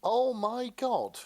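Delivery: drawn-out, high, loud oh my god; delighted gasp. Real, not acted. Reacting to a ghost hunter Stede fic, drawn-out delight. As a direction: let the delight spill out slowly.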